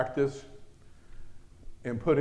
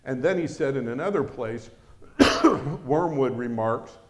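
ordinary talk, nothing marked

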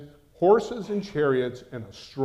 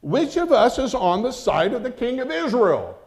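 furious demand; 11 semitones higher, 9 dB louder than usual. Acted. Enraged king demanding his officers name the traitor, loud and high. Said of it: "he demanded of them".

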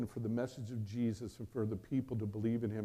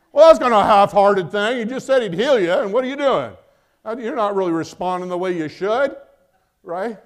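mock scolding; 8 semitones higher, 17 dB louder than usual. Son rebukes mother's weak faith.

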